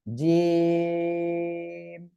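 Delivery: জিম with the "ee" held long on a level note; long drawn-out. Shown as a demonstration. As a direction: intone জিম very slowly, closing on m. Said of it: "তিন আলিফ টেনে পড়তে হবে".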